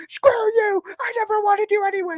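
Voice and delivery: high, loud wounded yell; Happy Gilmore screaming at his girlfriend as she leaves. Retold or imitated. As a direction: scream it at her, half crying. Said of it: "And he's like".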